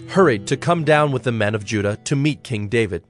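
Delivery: ordinary talk, nothing marked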